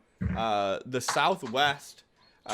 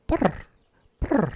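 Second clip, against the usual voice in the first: purring call; 7 semitones higher, 6 dB louder than usual. Shown as a demonstration. GM voices the creature's sound.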